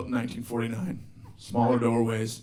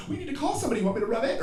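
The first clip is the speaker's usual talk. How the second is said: much higher, soft, fussy concern; doting girlfriend; 10 semitones higher, 3 dB quieter, much faster than usual. Retold or imitated. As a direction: coo at him like a worried new girlfriend. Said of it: "a girlfriend would be like".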